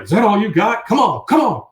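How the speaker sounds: high, loud taunting yell; egging on the bull, shouting. Retold or imitated.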